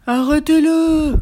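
loud, high, drawn-out shout; shouting cow. A narrator's reading. Cow shouts to stop the runaway, drawn-out cry. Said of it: "cria la vache".

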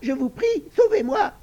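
a frightened little pig begging breathlessly; broken pleading piglet. Acted. Little pig begs the two sisters to save him, high broken pleading. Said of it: "les supplia d'une voix entrecoupée".

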